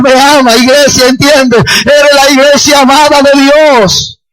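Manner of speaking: sustained, fervent pulpit proclamation; impassioned sermon climax, driving on without letup; full-force preaching. Real, not acted. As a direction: proclaim it to the whole church with mounting fervour.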